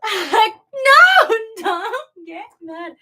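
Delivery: high fake sob, slow; mock crying. Streamer pleading with viewers, hammed-up fake crying. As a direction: whimper it like a pretend cry.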